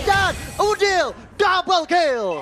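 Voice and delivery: shouted, high, stretched-out kill call; fighting game announcer. Real, not acted. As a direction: scream the double kill slowly.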